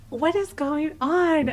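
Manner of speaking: high, slow tearful wail; hammed-up crying question; mock bawling. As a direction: draw it out in weepy disbelief. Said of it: "I start bawling my eyes out".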